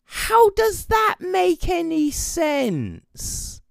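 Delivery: high, slow incredulous question. Retold or imitated. Vlogger to viewers, baffled by the plot, slow incredulous. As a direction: drag it out in disbelief. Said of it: "And you're like".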